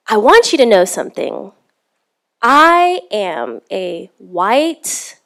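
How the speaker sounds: slow, emphatic retold announcement; solemn declaration. Stranger addressing a woman at the rental counter, slow emphatic declaration. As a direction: lean hard on each word, drawing it out.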